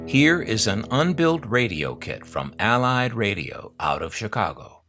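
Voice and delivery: usual speaking voice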